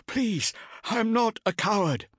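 high, strained plea, stress on not; desperate begging. Acted. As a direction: beg through the agony. Tortured man begs.

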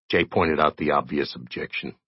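ordinary delivery, nothing marked

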